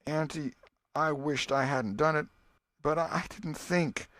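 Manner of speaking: put-on a sorry boy stammering an excuse; contrite boy. Tom apologising to his aunt, contrite and halting.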